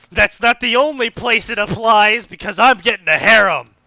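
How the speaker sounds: loud, emphatic declaration; loud proclamation. Acted. Reader loudly answering the laziness complaint, loud proclamation. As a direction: proclaim it at full volume.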